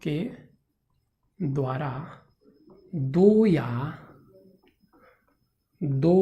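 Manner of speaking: isolated words with long gaps; slow dictation. Shown as a demonstration. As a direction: dictate one word at a time. Dictating word by word while writing. (एक-एक शब्द रुक-रुककर लिखवाते हुए)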